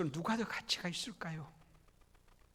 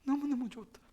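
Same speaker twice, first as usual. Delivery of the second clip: God delighted; 9 semitones higher, faster than usual. Acted. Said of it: "하나님이 막 감동하세요".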